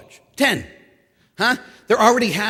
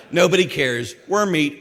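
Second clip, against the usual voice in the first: ordinary talk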